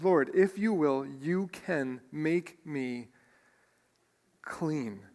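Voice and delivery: slow down drastically, dropping low and soft on CLEAN; each word weighted, long pause before a hushed CLEAN. Acted. Preacher showing congregation the plea's weight, slow and weighted. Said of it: "every word in this phrase is weighted".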